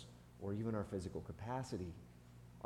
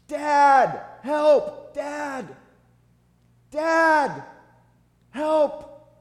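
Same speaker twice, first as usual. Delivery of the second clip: repeated high, very loud yells; hollering for help; 17 dB louder, much slower than usual. Retold or imitated. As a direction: holler for dad like a stuck kid. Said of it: "Kept hollering".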